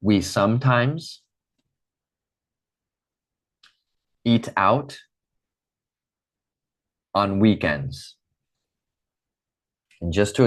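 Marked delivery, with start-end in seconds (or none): 0.0-8.2 slow model phrase. Shown as a demonstration. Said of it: "Repeat after me".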